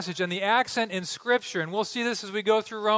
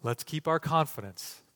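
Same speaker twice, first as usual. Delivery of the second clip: hushed solemn preaching; 10 semitones lower, 11 dB quieter than usual. Real, not acted. Preacher urging the church to keep confidence in God, hushed and solemn. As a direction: close in quiet, weighty assurance.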